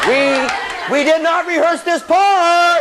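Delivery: slow, drawn-out wail of alarm; panicked freshman. Acted. Freshman lost on the field mid-show, panicked. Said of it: "freshmen are always like".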